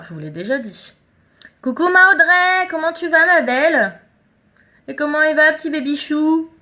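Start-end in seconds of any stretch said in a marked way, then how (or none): 1.6-6.5 high, loud, cooing hello; sing-song high-pitched sweet-talk; affectionate greeting. Real, not acted. Cooing greeting to friend and baby.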